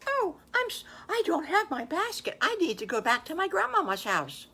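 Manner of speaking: put-on a small girl flustered, explaining herself; little girl voice.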